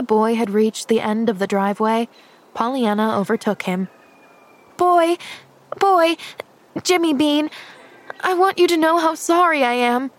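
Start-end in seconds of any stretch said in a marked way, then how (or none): 4.8-10.1 put-on high, urgent child calling out; mildly panted. A narrator's reading. Pollyanna overtakes the boy to apologise, urgent, mildly panting. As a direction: call after him with a hint of breathlessness. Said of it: "she panted".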